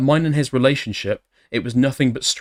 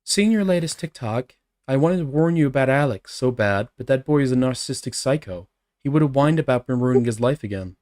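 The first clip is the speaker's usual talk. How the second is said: put-on girl voice. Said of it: "my girl voice".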